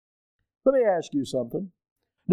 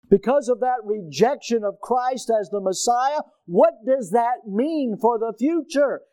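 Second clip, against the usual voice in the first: higher, louder preaching build; fervent preaching; 7 semitones higher, 3 dB louder than usual. Acted. Preacher warning the congregation about Israel's future, fervent preaching. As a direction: drive the point home from the pulpit.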